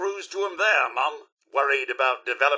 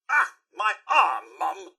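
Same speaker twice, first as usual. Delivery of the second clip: pained cry. Acted. Robot butler crying out as his arm is torn off. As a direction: yelp it as the arm comes off.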